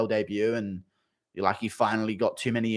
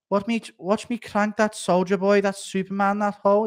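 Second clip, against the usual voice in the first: [name] lyrics in a higher mock Darren Till accent, half sung; 8 semitones higher, 3 dB louder than usual. Streamer reads [name] lyrics for a donor in a higher Darren Till accent. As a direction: rap the lyrics like Darren Till would, pitched up. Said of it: "read the lyrics of [name] by [name] in a Darren Till accent".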